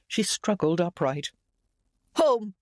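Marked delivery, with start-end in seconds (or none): 2.1-2.5 high, sharp command; imperious order. A narrator's reading. Ailing mother demanding to leave, imperious. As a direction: order it like an offended matriarch, pitched up. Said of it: "she ordered".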